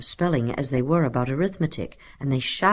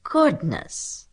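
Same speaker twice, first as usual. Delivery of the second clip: higher-pitched exclamation of disgust; 7 semitones higher than usual. A narrator's reading. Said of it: "said Stuart in disgust".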